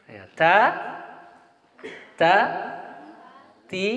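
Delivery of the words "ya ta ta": ordinary talk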